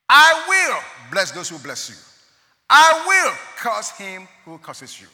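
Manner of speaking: loud, commanding reading; voice of God. Acted. Preacher voicing God's promise to Abraham loudly. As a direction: proclaim it as God speaking.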